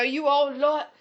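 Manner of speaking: high, hammed-up British reality-show voice; gushing mock British accent; Love Island British accent. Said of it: "people from Love Island".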